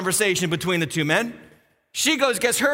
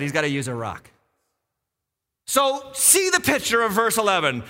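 usual speaking voice

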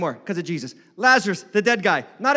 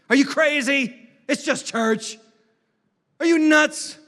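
raised, louder scoffing challenge; incredulous critic; 6 semitones higher, 4 dB louder, slower than usual. Acted. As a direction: act out an outraged doubter. Voicing critics of giving.

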